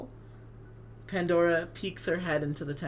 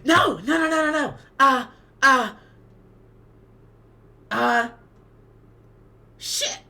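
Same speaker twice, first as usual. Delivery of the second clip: loud, high, frantic protest; a real panicked no-no-no; 8 semitones higher, 8 dB louder than usual. Real, not acted. Player panics at the surprise reveal with a loud protest.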